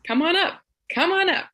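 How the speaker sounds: high, bright-pitched "come on up"; welcoming invite. Retold or imitated. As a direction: call them up eagerly, pitch lifting. Chief inviting, calling warmly.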